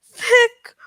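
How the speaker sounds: plain reading voice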